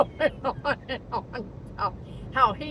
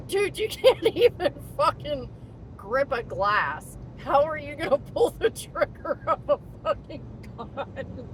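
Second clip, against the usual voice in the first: half-laughing, pitched up; laughing incredulous; 5 semitones higher than usual. Retold or imitated. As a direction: disbelief through laughter. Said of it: "I'm thinking in my head".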